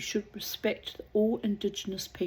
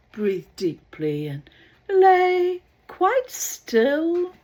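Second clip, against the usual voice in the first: slow soothing call; 10 semitones higher, 9 dB louder, much slower than usual. Acted. Drowning man soothes.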